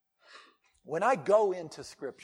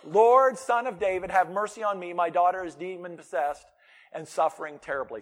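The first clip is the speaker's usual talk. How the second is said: loud pleading cry of a desperate mother; 7 dB louder than usual. A narrator's reading. A mother begging Jesus for her daughter, loud pleading cry. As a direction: beg him loudly, voice raised. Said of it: "crying out".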